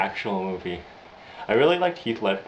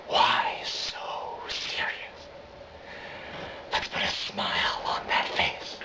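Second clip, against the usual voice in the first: harsh, drawn-out stage whisper; Joker whisper; 4 semitones lower, 6 dB quieter, much slower than usual. Acted. Joker taunting a child, menacing whisper. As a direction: whisper it slowly like a menacing villain. Joker's scars monologue climax.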